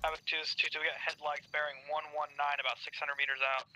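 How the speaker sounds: tinny crackly radio callout; over the military radio. Real, not acted.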